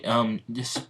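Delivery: plain reading voice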